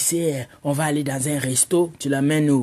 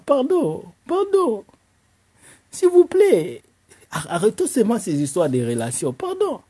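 mock pleading; 7 semitones higher than usual. Begging the girls to stop.

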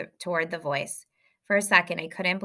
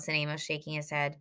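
usual speaking voice

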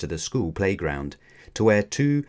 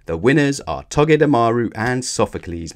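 loud winners' announcement; raised, ceremonial announcer call; announcing; 3 semitones higher, 6 dB louder than usual. A narrator's reading. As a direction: proclaim it to the crowd. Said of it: "Mallow announced".

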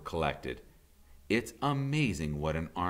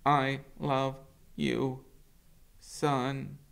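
higher, slow robot voice; robot mother's goodbye; 10 semitones higher, much slower than usual. Acted. Roz to her son at parting, slow and gentle. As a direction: answer slowly and gently as the mother. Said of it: "Brightbill hugged his mother's worn face".

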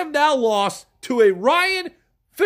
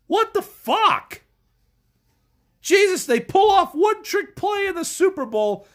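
higher, exasperated shout; fed-up fan yelling at his team; angry shout; 7 semitones higher than usual. Real, not acted. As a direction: bellow it in disbelief.

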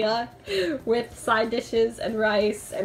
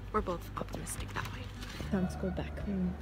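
whispering; 4 semitones lower, 8 dB quieter than usual. Real, not acted. Quiet night walk.

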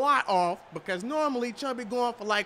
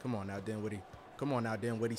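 low mutter; 12 semitones lower, 8 dB quieter, much faster than usual. Real, not acted. Low pleading to player.

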